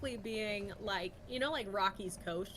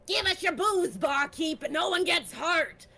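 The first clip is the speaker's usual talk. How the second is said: high, loud gravelly goblin demand; 7 semitones higher, 8 dB louder than usual. Acted. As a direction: growl it like a space goblin thug. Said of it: "in a gravelly voice".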